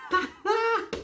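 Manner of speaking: sudden high giggle; high burst of laughter. Real, not acted. Vlogger cracking up at Ronda's line, high giggle.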